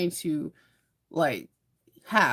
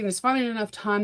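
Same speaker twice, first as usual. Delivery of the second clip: ordinary delivery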